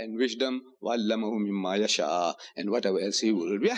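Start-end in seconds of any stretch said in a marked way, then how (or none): 0.8-2.3 rapid Arabic verse recited from memory; brisk recitation of the Arabic text; quick Quran recitation. Acted.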